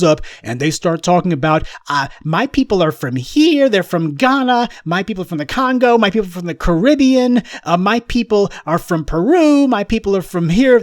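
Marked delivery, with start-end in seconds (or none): 2.2-10.8 higher, singsong mock boast; mock bragging. Commentator parodying people bragging of roots, higher mock voice. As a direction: parody a proud heritage brag, pitched up.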